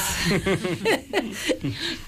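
a short bright burst of real laughter. Real, not acted. Mother laughing as she retells her son's bargain, real laugh. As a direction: laugh at your own punchline.

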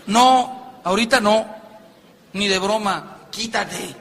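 acted annoyed rebuff; irritated refusal. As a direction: wave them away with sharp, loud bursts. Acted husband rebuffs wife during match.

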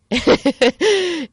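high, loud burst of laughter; sudden laugh breaking into the story. Real, not acted. Laughing while telling of crying.

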